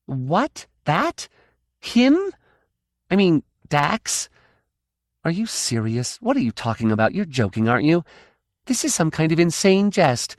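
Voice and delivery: high, disbelieving, rising; can't believe what she hears; incredulous outburst. Acted. Kay refusing to believe the kitten is the weapon, incredulous.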